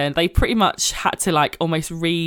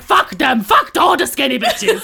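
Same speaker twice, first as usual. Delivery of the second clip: put-on higher, loud Georgian-accented shout, laughing; 8 semitones higher, 4 dB louder than usual. Seamstress Nini dismisses skinny brides, laughing Georgian shout. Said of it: "I'm gonna try and do her accent".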